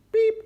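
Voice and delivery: very high, short beep; high electronic-style blip; scanner beep. A narrator's reading. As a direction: imitate a checkout scanner's beep. Imitating the checkout scanner.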